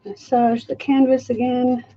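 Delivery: usual speaking voice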